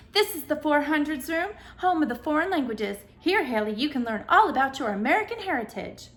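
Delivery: proud host showing off the room; booming announcer. A narrator's reading. Ceiling announcer greeting Haley in the languages room, booming. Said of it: "boomed a voice from the ceiling speakers".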